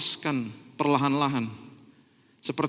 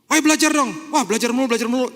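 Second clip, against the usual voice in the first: nagging parent; 6 semitones higher, 4 dB louder, faster than usual. Retold or imitated. Parent orders, child whines.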